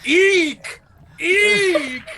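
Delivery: high squeaky animal eek; jackalope eek. Acted. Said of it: "make this braid sound of eek".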